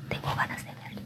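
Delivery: unvoiced secret whisper. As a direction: breathe it as a guilty secret. Confesión íntima en susurro. (susurro culpable)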